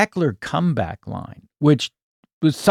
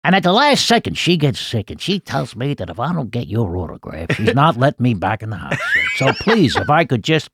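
raised, rambling Columbo voice; begging for an autograph like Columbo; Columbo impression; 4 semitones higher than usual. The fan in character, pleading with Rich Little for an autograph. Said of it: "this is what I might say".

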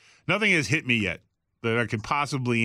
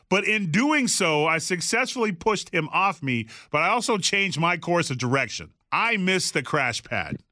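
usual speaking voice

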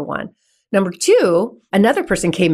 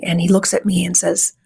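ordinary talk

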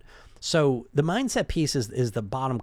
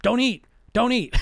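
higher, louder snapped order to yourself; mock scolding; 9 semitones higher, 6 dB louder than usual. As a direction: scold yourself like a strict dieter. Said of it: "And you're like".

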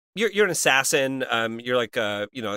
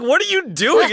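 shocked; 12 semitones higher, 7 dB louder than usual. Retold or imitated. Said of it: "I was like".

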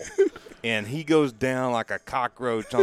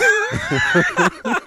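high, loud laugh breaking out; real laugh at the story; loud burst of laughter; 10 dB louder than usual. Real, not acted. Laughs at the memory.

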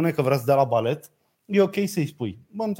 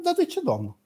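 higher, mock-innocent asking; mock innocent question; 11 semitones higher, 4 dB quieter than usual. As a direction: play it falsely polite and high. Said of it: "Și eu am întrebat". (întrebare falsă, nevinovată, pe ton înalt)

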